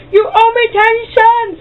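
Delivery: very high, slow put-on voice; mock shopkeeper. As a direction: caricature the angry clerk. Imagined shopkeeper chasing.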